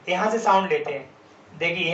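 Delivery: ordinary talk, nothing marked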